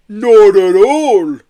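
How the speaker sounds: loud, high, very slow denial; drunken protest. A narrator's reading. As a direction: protest like a tipsy yeti. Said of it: "protested Marbo".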